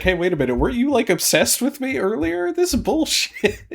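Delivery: high-pitched, mounting mock outrage; imitated indignant anger. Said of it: "And she gets mad".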